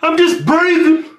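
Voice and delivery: loud, high, drawn-out protest; exasperated protest. Real, not acted. Accused of breathing too loud, exasperated protest. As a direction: shout it in self-defence. Defends himself against breathing complaint.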